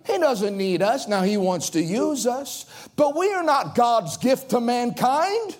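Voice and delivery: normal talking voice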